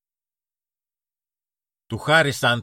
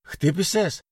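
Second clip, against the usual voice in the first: frightened question; 9 semitones higher than usual. A narrator's reading. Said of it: "ρώτησε ο Κωστάκης φοβισμένα".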